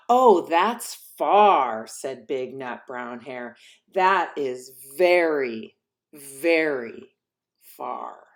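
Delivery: slow, loving then fading to a whisper; tender fading. Acted. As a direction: hush him to sleep. Big hare, slow, fading.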